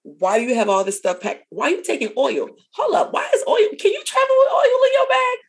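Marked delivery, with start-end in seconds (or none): 2.8-5.4 high, loud incredulous cry; mock shock. Friend questioning oil in a relative's bag, shocked and high. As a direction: act out a baffled customs agent. Mock customs officer disbelief.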